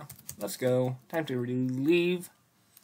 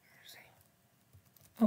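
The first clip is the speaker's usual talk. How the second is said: slow hushed whisper; 9 dB quieter, much slower than usual. Real, not acted. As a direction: creep and whisper it.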